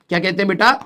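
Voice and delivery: normal talking voice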